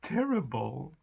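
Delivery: high, slow, hammed-up dismay; high slow mock horror; mock sympathy. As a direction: fake shocked sympathy, drawn out. Mocking fake sympathy.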